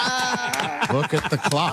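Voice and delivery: laughing. Real, not acted. Laughs as time runs out.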